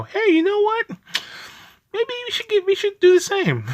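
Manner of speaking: high, bright mock voice of another country; mock foreign countries. Other countries eyeing the US policy, mock high voice. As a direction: pitch way up as a copycat nation musing. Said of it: "other countries will look at this and go".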